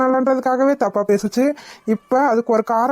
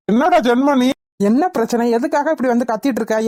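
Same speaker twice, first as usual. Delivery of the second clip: ordinary delivery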